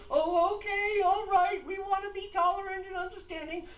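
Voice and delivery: high, whiny caving official; mocking mayors. Host mocks the mayors, high and whiny. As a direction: squeal it out like a spineless mayor.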